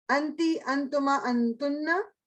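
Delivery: slow, drawn-out अंति, अंतुमा, अंतुन्ना with a rising end; drilled feminine pronouns. Shown as a demonstration. Teacher drilling feminine pronouns with the class, slow and stretched. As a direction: recite अंति, अंतुमा, अंतुन्ना slowly, stretching the last word. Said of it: "अब feminine".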